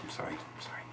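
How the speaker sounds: whispered meek apology; hushed sorry after being told off; sheepish whisper. Acted. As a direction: whisper it, cowed.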